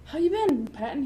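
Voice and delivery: high, chummy mock greeting; retold oblivious hello; Bob. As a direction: greet him breezily, voice lifted. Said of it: "and Bob's like".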